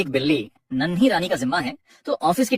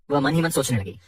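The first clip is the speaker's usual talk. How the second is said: ordinary delivery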